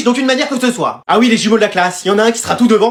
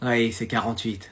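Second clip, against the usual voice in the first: very low, soft inner voice; secret telepathy; 11 semitones lower, 12 dB quieter than usual. Acted. Twin feeding brother an exam answer, hushed telepathy. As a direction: answer in a deep hush.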